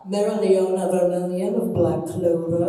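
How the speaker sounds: low, loud chest-voice character; Mereoleona Vermilion. Shown as a demonstration. Voice actor naming her role, low resonant chest voice. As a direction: announce it from deep in the chest. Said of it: "she's got kind of that".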